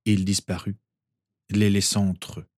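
ordinary delivery

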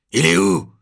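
loud, high angry growl. A narrator's reading. Lieutenant growling through the door, loud and angry. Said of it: "grogne-t-il juste derrière la porte".

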